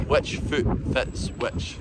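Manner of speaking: slow, quiet, word-by-word phrasing; hushed, slowed-down question for comparison; standard English version. Shown as a demonstration. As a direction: softly space out each word. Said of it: "asking you which foot fits which is".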